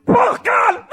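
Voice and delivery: loud, high "Oh, God!" of shock; startled cry. Retold or imitated. Storyteller recalling the launch, loud startled cry. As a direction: yelp it like the machine just shot off.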